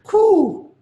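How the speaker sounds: high dove call; dove coo. Acted. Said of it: "One was a dove".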